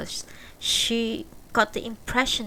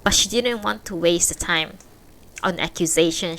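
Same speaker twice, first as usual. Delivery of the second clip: ordinary delivery, nothing marked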